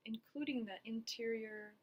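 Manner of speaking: unhurried meditation guidance with long pauses; guided body scan. Acted. Therapist guiding listeners through a body scan, calm and spacious. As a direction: lead them gently through it, leaving space after each phrase.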